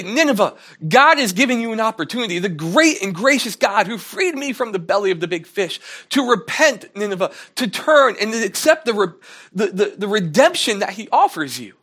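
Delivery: high, loud, fervent appeal to Nineveh; passionate plea. Pretend. The message Jonah should have brought Nineveh, a high loud fervent plea. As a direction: preach it like a fervent revival call.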